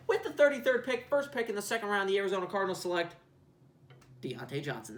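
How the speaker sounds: mock commissioner announcing the selection; draft announcer. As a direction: announce the pick like it's draft night.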